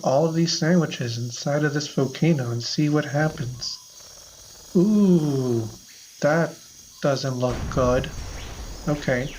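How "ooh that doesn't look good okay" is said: ordinary delivery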